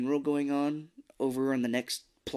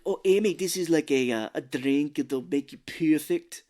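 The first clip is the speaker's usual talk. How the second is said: louder, hammed-up Schwarzenegger-like accent; Aunt Felice's accent; 3 semitones higher, 4 dB louder than usual. Co-host as aunt offering a drink, hammed-up mock Austrian accent. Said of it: "that's how I'm gonna do that".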